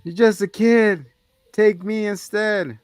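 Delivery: high, slow melodramatic plea; hammed-up heroic plea. Retelling Arnie offering himself for the boy, hammed-up plea.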